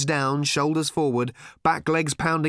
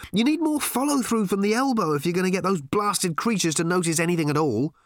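a lightly voiced Ford, higher and peevish; Ford complaining; 7 semitones higher than usual. A narrator's reading. Ford criticizing Arthur's towel technique, complaining. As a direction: grumble at your friend's technique. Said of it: "complained Ford".

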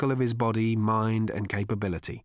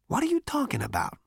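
baffled Zaphod; 14 semitones higher than usual. Acted.

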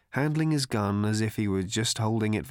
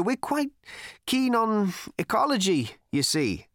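put-on higher, pleading young man; earnest worried cricketer; 10 semitones higher, 3 dB louder than usual. A narrator's reading. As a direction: explain your worry politely. Another cricketer explaining.

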